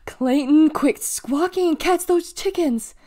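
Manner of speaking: high, loud, drawn-out holler. A narrator's reading. Officer ordering Clayton to catch chickens, hollering. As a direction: holler orders across the fairground.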